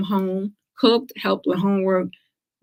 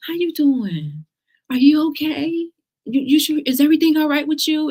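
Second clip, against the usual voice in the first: mock pity; 9 semitones higher, 4 dB louder than usual. Acquaintance checking on a cancer survivor, mock pity. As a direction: imitate overdone concern, pitched way up. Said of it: "with that voice".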